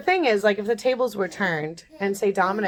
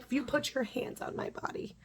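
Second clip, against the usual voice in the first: hushed mock threat; whispered warning; 5 semitones higher, 12 dB quieter than usual. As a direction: warn her off under the breath. Hushed warning to the other mom.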